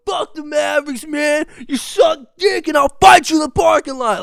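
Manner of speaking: put-on high, building drunken rant; hammered fan yelling. Drunk fan challenging rivals to fight, rowdy yelling. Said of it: "hammered going".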